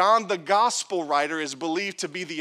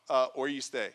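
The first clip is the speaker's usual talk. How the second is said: mock awkward reply; 5 semitones lower, 4 dB quieter than usual. Mocking disciples' awkward answer.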